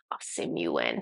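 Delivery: very low, whispered, drawn-out name; Ah Sim Yuen's deep voice. Retold or imitated. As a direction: drop far down and breathe out the dragon's name slowly. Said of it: "he says it slowly and deep".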